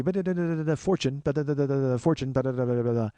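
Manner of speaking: nonsense syllables imitating overheard Chinese talk; mock Chinese chatter. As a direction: babble like half-understood Chinese conversation. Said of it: "all I hear is like".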